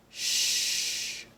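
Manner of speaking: Sh as a long hushing shh; sh sound. Shown as a demonstration. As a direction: push air out through closed teeth. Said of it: "So for this one".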